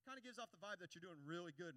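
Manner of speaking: ordinary delivery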